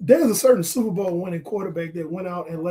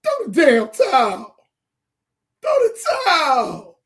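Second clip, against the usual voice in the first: mock pleading; 4 semitones higher, 4 dB louder than usual. Real, not acted. Pleading with coaches, half laughing.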